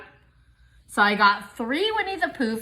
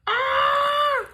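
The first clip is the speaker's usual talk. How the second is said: very high joyful scream; short high squeal; delighted shriek; 4 dB louder than usual. Real, not acted. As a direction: scream with glee. Seeing bow.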